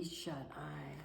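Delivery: whispered drowsy name; sleepy fade. Acted. Narrator fading on the very sleepy friend, whispered.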